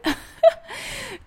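short high burst of laughter; amused laugh. Real, not acted. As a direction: laugh at the absurd idea. Laughs at her own joke.

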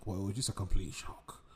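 normal talking voice

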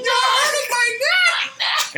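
piercing high shriek, drawn out; hammed-up pained scream. Coworker pretending the cook hurts her, piercing scream. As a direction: wail it slowly in mock agony.

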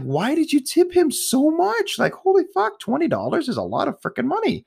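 very high-pitched mock outrage; retold shock, voice shooting up high; incredulous. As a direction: squeal in disbelief at the overpayment. Retold shock at friend's tip.

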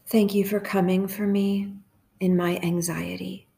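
hushed, even prayer with long pauses; guided meditation prayer. Real, not acted.

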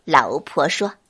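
usual speaking voice

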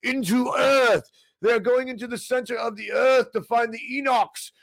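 high, hammed-up panic announcement; mock alarm. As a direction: sound the alarm in an overblown high voice. Absurd mock conspiracy tale, same voice.

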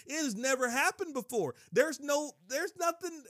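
high, worked-up amazement; overwhelmed, exclaiming disbelief; astonished preaching. Real, not acted. Preacher marvels at unheard-of resurrection.

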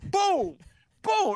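high mock crash noises; boom sound. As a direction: imitate the lamps smashing. Imitating lamps crashing.

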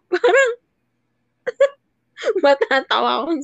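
laughing through the words; words spilling out mid-laugh; after laughing hard. Real, not acted. Laughing at own demon joke.

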